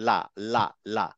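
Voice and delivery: লা with the tongue tip touching just behind the front teeth; light la. Shown as a demonstration. Teacher demonstrating Arabic lam to students with the tongue tip. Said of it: "বাতাসটা দুই side থেকে বেরোবে".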